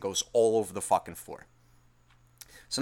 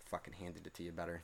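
muttered under breath; 7 semitones lower, 21 dB quieter than usual. Retold or imitated. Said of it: "crunching my fucking teeth".

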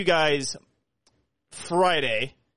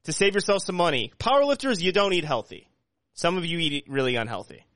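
ad read; 4 semitones higher than usual. Acted. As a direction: sell it like a radio ad. Podcast host reads sponsor ad.

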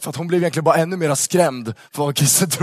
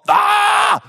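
a sudden high yelp of horror, much louder; mock shriek of disgust; 5 dB louder than usual. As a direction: shriek like you've been provoked. She reacts to church talk.

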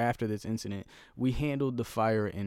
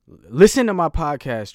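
loud, raised-pitch outburst; exasperated shout; 6 semitones higher, 13 dB louder than usual. Real, not acted. Podcaster demanding a friend finally listen, exasperated shout. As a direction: burst out pleading, far louder. Host scolds absent friend Travis.

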